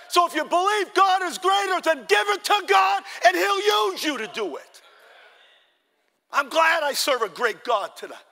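high, loud shouted exhortation; impassioned pulpit shout; full-force preaching. Real, not acted. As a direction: drive it home at the top of your voice.

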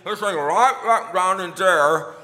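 loud garbled words round an object; mouth full. Shown as a demonstration. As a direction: talk with something stuck in the mouth. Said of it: "the thing is in my mouth".

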